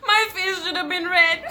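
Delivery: high, loud, laughing through the line. Real, not acted. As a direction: crack up while saying it. Laughing at her own punchline.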